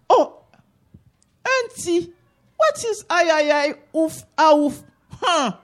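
put-on high, puzzled child's voice; small boy's voice. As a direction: ask like a confused little boy. Little boy asking auntie.

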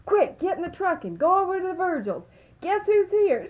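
very high, loud excited call; urgent holler. Retold or imitated. Miss Mary sends Marvin off to the Virgils, hollering urgently. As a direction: holler urgent news across the house.

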